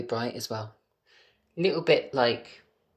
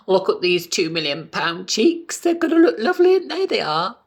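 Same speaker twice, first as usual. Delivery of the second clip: giddy excitement; 9 semitones higher, 7 dB louder than usual. Pretend. Admiring his future Botox cheeks, gushing much higher. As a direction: gush over them like a diva.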